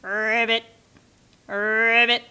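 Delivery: a croaky ribbit; a hint of a frog's croak. Shown as a demonstration. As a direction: croak it like a frog. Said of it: "friendly frog".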